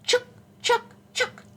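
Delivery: high, sharp squirrel chucks; squirrel chatter. A narrator's reading. Teacher showing children what mama squirrel says, high squirrel chatter. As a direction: squeak it out in quick separate bursts. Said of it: "And she says".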